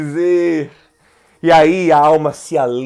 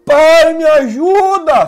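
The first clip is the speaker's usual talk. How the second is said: higher and louder, slow, crying out for help; pleading cry; 9 semitones higher, 7 dB louder, slower than usual. Shown as a demonstration. Pastor shows supplication to his live audience, a high, slow plea. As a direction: beg the Father, stretching it out. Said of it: "é súplica".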